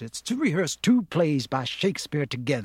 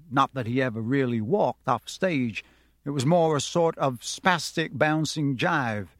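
plain reading voice

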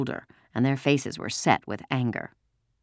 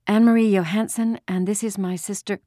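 put-on nervous girl answering; 7 semitones higher than usual. A narrator's reading. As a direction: answer the officer dutifully. Girl answers the barking officer.

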